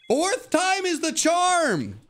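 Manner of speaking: very high, slow sing-song voice; high squeaky hype. Acted. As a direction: squeak it out like a cartoon hype-man. Shiny hunting, hyping himself up.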